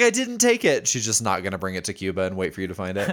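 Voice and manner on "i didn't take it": high, mock-innocent protest; mimicked denial. Cohost as the accused grandmother, mock innocence.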